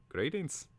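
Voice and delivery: high-pitched creature voice, rising at the end; bird's greeting. A narrator's reading. Caterbird formally greeting Twig, in high bird voice.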